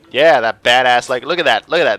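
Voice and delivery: loud, cocky brag; boastful bragging. Acted. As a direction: show off the scar with swagger. Mogan brags about his scar.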